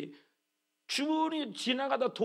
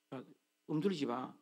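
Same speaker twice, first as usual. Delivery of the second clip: usual speaking voice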